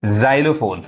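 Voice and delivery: जाइलोफोन starting with a 'j/z' sound; x read as j. Shown as a demonstration. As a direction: say जाइलोफोन as 'JAI-lo-fon'. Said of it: "जो पढ़ाता है". (जाइलोफोन को 'ज' से शुरू करें)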